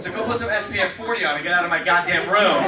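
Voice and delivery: loud comic rant with a laugh in it; hammed-up yell at his sister; mock yell. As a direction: yell her out of the room. Kicking sister out, yelling.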